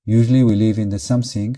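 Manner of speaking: usual speaking voice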